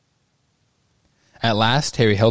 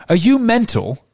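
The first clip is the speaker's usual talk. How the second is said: much higher and louder incredulous cry; loud disbelief; 12 semitones higher, 3 dB louder than usual. A narrator's reading. Ron shouting at Harry over the Elder Wand. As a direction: Ron thinks his friend has lost it. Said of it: "said Ron loudly".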